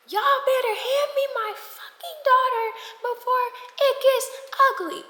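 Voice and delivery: high, loud threatening mom; angry mom. Retold or imitated. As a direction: demand her daughter back, furious. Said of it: "talking about some".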